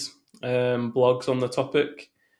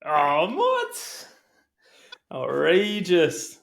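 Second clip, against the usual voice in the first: high-pitched shocked outburst, drawn out; real football fan's outrage; indignant disbelief; 6 semitones higher, much slower than usual. Real, not acted. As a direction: protest the goal in stretched-out disbelief. Reacting to unwelcome goal news.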